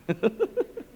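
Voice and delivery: high chuckle at own joke; quick giggling chuckle. Real, not acted. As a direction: let out a short, amused laugh.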